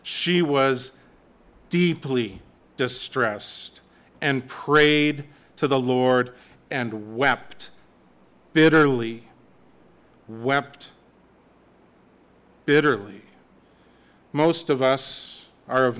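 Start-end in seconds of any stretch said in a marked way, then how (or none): 0.0-9.2 slow, weighty reading of the verse; slow, deliberate scripture reading. Shown as a demonstration. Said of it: "we pass by stuff like that altogether too quickly". 10.3-13.2 "Wept" then "bitterly", each set apart by long pauses; "Wept" ... "bitterly" spoken slowly as two weighty words; slow emphasis. Shown as a demonstration. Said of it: "altogether too quickly".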